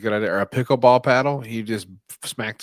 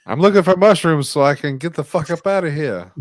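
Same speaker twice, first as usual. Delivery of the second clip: loud, raised sarcastic comeback; mock indignant shout; 6 semitones higher, 8 dB louder than usual. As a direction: shout the joke answer as the trapped kid. Acting the boy's sarcastic answer.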